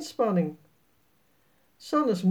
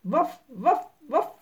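higher yapping woof from a little dog; small dog barking; 7 semitones higher, slower than usual. A narrator's reading. The little dog barks as it runs towards the children. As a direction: yap like a puppy running up.

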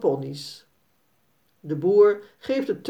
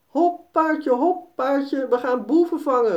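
put-on high eager child voice, faster at the end; excited child cowboy cry; 7 semitones higher than usual. Boy on a galloping pony urges it on, high and excited. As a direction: cheer the pony on like a little cowboy. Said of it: "roept Jasper".

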